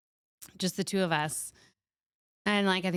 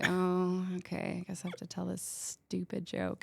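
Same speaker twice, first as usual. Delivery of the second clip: a much softer, reluctant "I guess I have to"; resigned mumble; 7 dB quieter than usual. Retold or imitated. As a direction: mutter it like a grudging chore. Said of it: "I was like".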